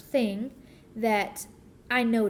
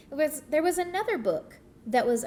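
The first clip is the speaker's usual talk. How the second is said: ordinary delivery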